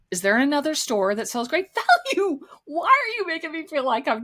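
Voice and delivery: high, incredulous demand with a laugh; exasperated retort. Retold or imitated. Customer's imagined comeback to manager, exasperated and high. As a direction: voice climbing in indignant disbelief.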